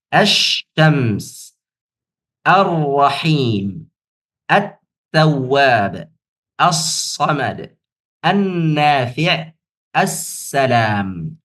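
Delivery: slow, drawn-out demo of each word; slow model reading. Shown as a demonstration. Teacher modelling Arabic words for students, slow and careful. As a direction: read each word slowly for students to copy. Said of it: "Demikianlah cara membacanya".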